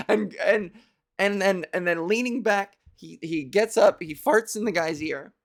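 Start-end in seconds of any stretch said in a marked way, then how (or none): none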